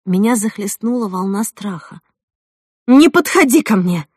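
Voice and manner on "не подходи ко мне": loud, higher warning cry; scared, raised voice; frightened cry. A narrator's reading. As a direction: shout in fear to keep someone back. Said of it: "закричала я".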